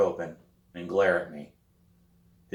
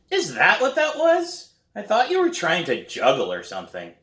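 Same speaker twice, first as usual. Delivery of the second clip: teasing little brother; 11 semitones higher, 5 dB louder than usual. A narrator's reading. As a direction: tease her in a much higher kid voice.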